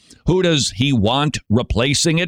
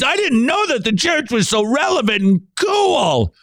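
a shouted, very high gushing churchgoer; mock excited churchgoer; 10 semitones higher than usual. Mocking seekers thrilled by Star Wars clips, shouted high and gushing. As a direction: ham up a gushing fan of a trendy church.

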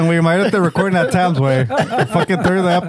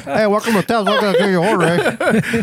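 lightly voiced, higher drive-thru greeting; mock drive-thru clerk; 4 semitones higher than usual. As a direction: play a cheery clerk at the mic, half-laughing.

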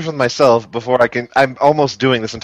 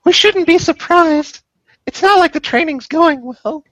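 put-on much higher, slower teen voice; high girl voice. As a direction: pitch it way up as Trini.

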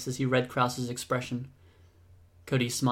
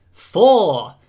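loud, high, triumphant-sounding shout; big drawn-out shout, easing slightly; called out; 5 semitones higher, 8 dB louder, slower than usual. A narrator's reading. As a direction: yell the count, then the taunt. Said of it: "shoved him and called out".

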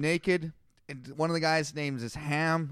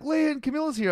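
chirpy announcement; 8 semitones higher, faster than usual. Retold or imitated. Retelling his own announcement.

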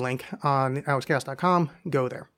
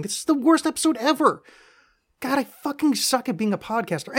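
exasperated outburst; 10 semitones higher than usual. Real, not acted. Host fed up with reading the letter aloud, exasperated. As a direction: pitch jumps high, fed-up self-mockery.

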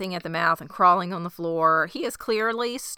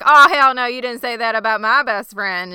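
loud, high, sassy outburst; mock indignation; 5 semitones higher, 9 dB louder than usual. Recapper voices Misty defending her best friend, loud mock indignation. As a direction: act outraged on a best friend's behalf.

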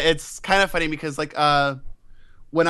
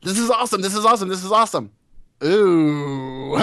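excited; 4 semitones higher than usual. Retold or imitated. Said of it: "it was like".

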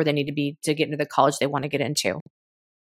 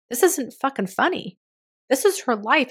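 higher pitched, indignant mock comment; indignant outrage; 6 semitones higher than usual. Quoting outraged commenters defending the crying host, indignant. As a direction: channel the angry commenters. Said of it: "comments in this clip were like".

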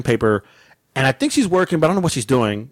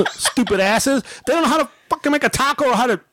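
high-pitched exasperated rant; retold angry rant; 10 semitones higher than usual. Customer mocking taco workers, pissed off. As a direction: keep ranting up high, indignant. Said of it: "I was pissed off".

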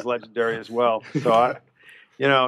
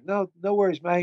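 higher, softer Aussie No, no worries; higher pitched, soft Australian twang; Australian accent; 7 semitones higher, 5 dB quieter than usual. Retold or imitated. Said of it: "his motto is".

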